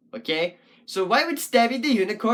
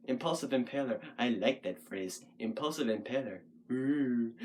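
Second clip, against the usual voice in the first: much lower and softer, savouring; musing to oneself; 9 semitones lower, 10 dB quieter than usual. Real, not acted. Puppet poet trying a new phrase to themselves, low musing. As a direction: murmur it low, testing the phrase.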